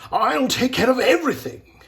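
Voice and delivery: high, loud eager promise; mounting excitement. A narrator's reading. Agent promises Bellini he will handle it, excited. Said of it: "with mounting excitement".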